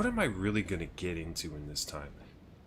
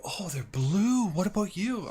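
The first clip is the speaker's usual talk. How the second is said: high, loud, drawn-out mock delight; imitating gushing woman; 12 semitones higher, 5 dB louder, slower than usual. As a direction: gush like an over-keen admirer. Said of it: "And she's like".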